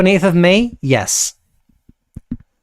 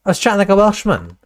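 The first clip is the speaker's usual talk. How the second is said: usual speaking voice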